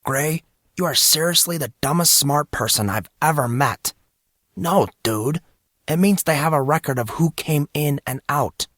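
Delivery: a hint of an impatient buddy; exasperated friend. Casey voiced by narrator.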